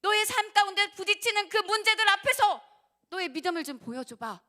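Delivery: fiery preacher calling out the listener's faith; challenging preaching shout. Acted. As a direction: throw the challenge straight at them.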